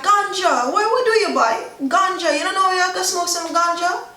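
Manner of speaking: high, fast, insistent patois voice; Jamaican patois. Retold or imitated. A Jamaican friend pushing ganja, in an insistent patois. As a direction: push the ganja on them, surprised they don't know.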